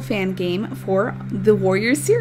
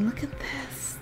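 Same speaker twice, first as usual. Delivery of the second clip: hushed whisper of wonder; soft astonished whisper; whispered awe; 4 semitones higher, 11 dB quieter than usual. Real, not acted. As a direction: breathe it out, amazed. Awed by the fog.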